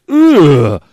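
loud, drawn-out groan of disgust; mock a revolted "ugh". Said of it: "she makes this face like".

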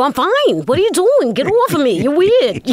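high, swooping mock outrage; retold indignant protest. Pushing away a colleague's hug, indignant. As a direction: push them away with a hammed-up high protest.

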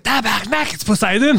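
hammed-up French Canadian greeting; French Canadian accent. Car guy to a French Canadian, mock French Canadian accent. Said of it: "like, a French Canadian".

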